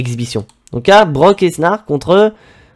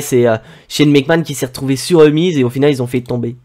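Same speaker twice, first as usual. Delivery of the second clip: usual speaking voice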